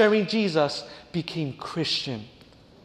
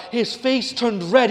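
ordinary talk

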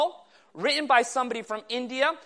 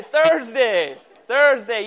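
loud, insistent repeated answer; emphatic correction; 7 dB louder, much slower than usual. Real, not acted. Preacher correcting the audience on the night off, loud and emphatic. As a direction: call it out firmly over the crowd.

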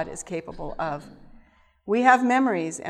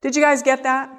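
usual speaking voice

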